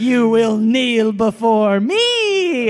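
slow, grand villain line read; Bill Nighy demon prince. Retold or imitated. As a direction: lay it on like a hammy demon prince. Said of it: "Crazy line read".

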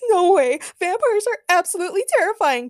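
very high, loud panic; terrified scream. A narrator's reading. Peter refuses the vampire movie, screaming in fear. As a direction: shriek and dive for cover. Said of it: "Peter screamed as he hid".

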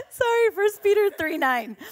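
high burst of laughter into sorry; preacher laughing at her own joke; laughing apology. Real, not acted.

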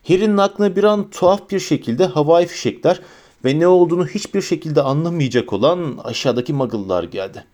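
ordinary delivery, nothing marked

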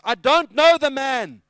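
slow, high, full shout; furious denial. Acted. Peter denying Jesus a last time, furious shout. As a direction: shout each word to shut them up.

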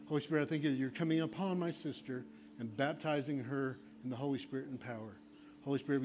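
low, hushed, earnest prayer over each person; laying-on-of-hands prayer. Acted. Pastor praying over a kneeling woman, hushed and earnest. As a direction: pray softly over her for the Spirit.

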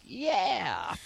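high-pitched mock victory yell; hammed-up triumphant shout; imitated yell. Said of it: "and, and yells".

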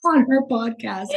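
giggling through the words; real amused laughter; laughing. Real, not acted. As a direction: laugh while telling it. Laughing retelling dad's message.

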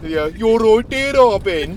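hugely exaggerated Dudley accent, drawn out. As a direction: bellow it slowly in mock Dudley. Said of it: "Strong, strong".

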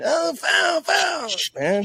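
very high mock shout of foul; whiny foul cry.

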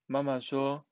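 ordinary delivery

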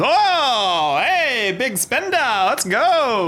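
high, slow game-booth barker; carnival barker. Acted. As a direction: cheer like a carnival stall man. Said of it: "And he goes".

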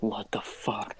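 hushed, breathy disbelief; shocked whisper. Retold or imitated. Storyteller to himself as she bites the bread, shocked whisper. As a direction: whisper it under your breath, stunned. Stunned aside at cashier's bite.

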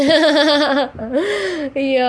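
high, loud laugh that cracks into crying; laughing and crying at once, overwhelmed; laugh breaking into a cry. Real, not acted. Emotional outburst about God.

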